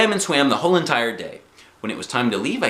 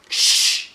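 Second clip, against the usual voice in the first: loud, hissed shush; 5 dB louder than usual. A narrator's reading. Daddy hushes his shouting daughter, shush. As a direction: hush a shouting child sharply.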